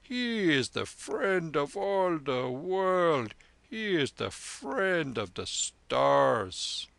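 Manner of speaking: put-on slow, drawn-out triumphant old monk; a proud holy man crowing over a miracle; proud lama. Lama character voice.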